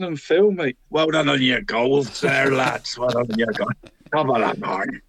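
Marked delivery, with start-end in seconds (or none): 0.9-3.0 light stage Irish lilt, congratulating; jokey Irish praise for the goal scorers; mock Irish accent. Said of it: "They both sound Irish". 3.0-3.7 light stage Irish lilt, congratulating; jokey Irish praise for the goal scorers; mock Irish accent. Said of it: "They both sound Irish". 4.1-5.0 lower, stage Irish sign-off; jokey Irish greeting, winding down; mock Irish accent. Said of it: "They both sound Irish".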